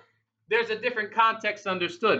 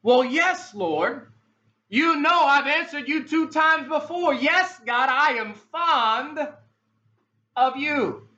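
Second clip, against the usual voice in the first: Peter exasperated; 6 semitones higher, slower than usual. Acted. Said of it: "third time he asks".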